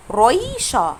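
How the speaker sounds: رويشة starting with a tongue-tip R; R without gh. Shown as a demonstration. Teacher shows alternative for learners, tongue-tip R. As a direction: say رويشة slowly as "roy-sha", no throat sound. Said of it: "لو ما نطقتش حرف ال R بالغين".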